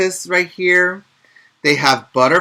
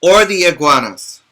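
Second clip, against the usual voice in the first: lively storybook read-aloud; picture book voice for young listeners; reading to children; 3 semitones higher, 4 dB louder than usual. Acted. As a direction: read it brightly to little kids.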